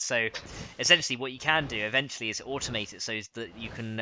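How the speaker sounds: normal talking voice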